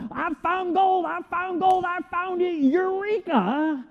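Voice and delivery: high, giddy cry of a man who struck gold; excited gold find. Retold or imitated. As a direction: shout it like you just struck gold. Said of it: "He kept everywhere he go".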